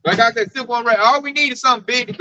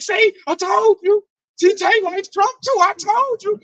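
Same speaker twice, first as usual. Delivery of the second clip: mocking coworkers; 9 semitones higher than usual. Coworkers egging Tay on.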